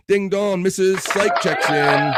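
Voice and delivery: high, loud call with stretched ending; doorbell announcement. Real, not acted. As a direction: sing out the arrival loudly.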